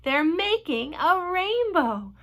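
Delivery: thrilled, drawn-out marvelling; awed excitement. A narrator's reading. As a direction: stretch it out in wonder. Everyone marvels at the rainbow.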